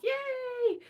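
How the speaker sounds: high, drawn-out yay; little cheer. Retold or imitated. Delighted at the Edinburgh link, high little cheer. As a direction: let out a high, happy cheer.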